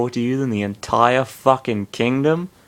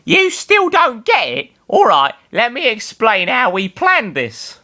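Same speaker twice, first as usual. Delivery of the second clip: much higher, smug voice; smug explainer; 11 semitones higher than usual. Acted. Sarah explains the plan to Bernard, smug high voice. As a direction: explain it like it's obvious.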